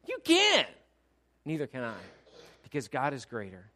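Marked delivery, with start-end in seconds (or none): 0.1-0.7 high, loud exclamation; emphatic shouted agreement; shouted retort. Real, not acted. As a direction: fire it back at full voice. Shouts back at imagined listener.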